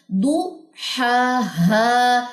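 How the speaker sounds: slow, drawn-out throaty हा हा with the two sounds kept apart; demo of two ha sounds. Shown as a demonstration. Teacher showing students how हा हा is said, very slow. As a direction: stretch each हा so the throat sound is clear. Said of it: "हा और हा का difference आप अपनी sound".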